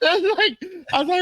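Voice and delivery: ordinary talk, nothing marked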